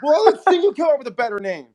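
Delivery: high, loud, huffy Jesus; sulky Jesus. Retold or imitated. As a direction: snap back with wounded pride. Jesus retorting to team.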